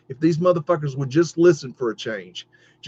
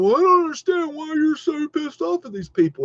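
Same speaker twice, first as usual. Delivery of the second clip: mock questioner; 12 semitones higher than usual. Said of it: "people ask me all the time".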